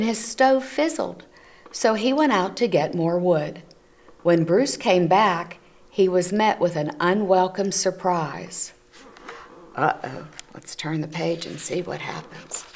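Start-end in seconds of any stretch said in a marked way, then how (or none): none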